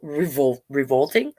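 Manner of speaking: plain reading voice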